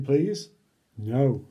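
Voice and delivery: usual speaking voice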